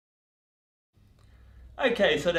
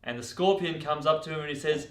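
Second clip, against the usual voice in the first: ordinary delivery, nothing marked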